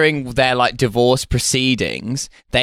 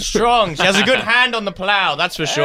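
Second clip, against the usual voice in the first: higher, hammy yokel; old farmer voice; 6 semitones higher than usual. Pretend. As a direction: boast of the daughter like livestock. Father selling his daughter.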